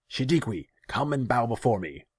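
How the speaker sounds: high, loud command; imperious summons. A narrator's reading. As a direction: order a servant to your throne. Ruler summons the naga.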